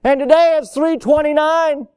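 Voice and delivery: loud, high-pitched mock protest; arguing customer. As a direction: argue the price like an indignant customer. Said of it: "You can't go argue and say".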